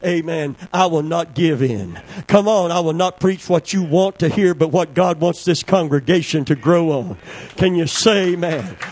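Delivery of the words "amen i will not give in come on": usual speaking voice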